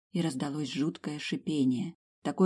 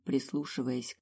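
ordinary delivery, nothing marked

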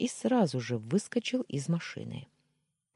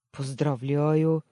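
slow, louder stunned congratulations; taken aback; 5 dB louder, slower than usual. A narrator's reading. Man stunned by friend's confession, louder and slow. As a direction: blurt it out, caught off guard. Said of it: "опешил я".

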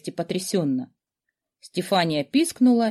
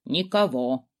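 muttering skull; 5 semitones lower, slower than usual. A narrator's reading. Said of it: "забормотал".

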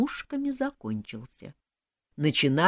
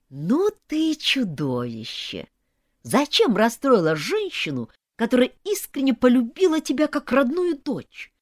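indignant scolding climbing high and louder; hissed accusation; 8 semitones higher, 5 dB louder than usual. A narrator's reading. Sisters scolding the bride, indignant hiss. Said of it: "зашипели на меня сёстры".

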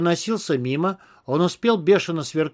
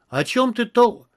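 startled question; 6 semitones higher than usual. A narrator's reading. Cut off mid-question.

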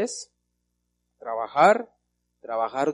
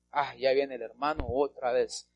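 ordinary delivery

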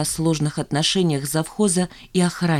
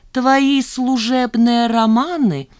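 very slow, higher, savoured repeat; relishing it; 5 semitones higher, 4 dB louder, much slower than usual. A narrator's reading. Chief accountant repeating her accusation, with drawn-out relish. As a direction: draw out every word with pleasure. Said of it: "с удовольствием повторила Велинская".

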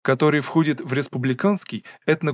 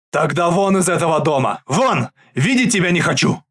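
loud, enraged yell; furious shout; 4 semitones higher, 5 dB louder than usual. Acted. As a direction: throw him out of the house. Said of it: "кричит папа". (яростный крик)

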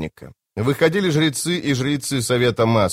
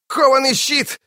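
a desperate cry, pitched much higher; shouted name. A narrator's reading. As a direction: call out to him in alarm. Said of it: "закричал он".

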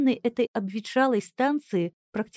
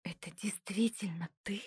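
frightened breathy whisper; 10 dB quieter than usual. A narrator's reading. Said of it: "прошептала Кристиана".

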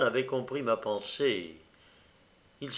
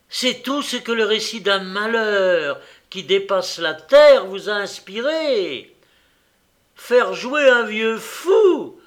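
much louder, higher furious tirade; roaring rage; 7 semitones higher, 8 dB louder than usual. Acted. Host roars at the young man, furious shouting. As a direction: roar it, foaming with anger. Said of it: "L'homme rugit".

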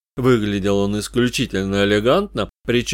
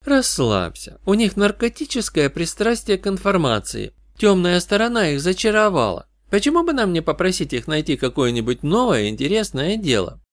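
higher-pitched female character, lively; Katie, lightly voiced; 8 semitones higher than usual. Partner chatting with the detective about a case, lightly voiced higher.

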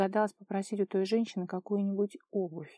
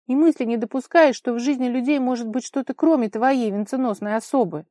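loud, higher, scornful rebuke; sarcastic reproach; 5 semitones higher, 8 dB louder than usual. A narrator's reading. Kolya accusing Vara of thinking only of herself, sarcastic. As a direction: lash out with sarcasm. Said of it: "саркастично произнёс он".

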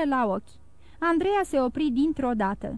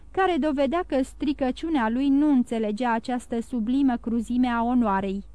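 usual speaking voice

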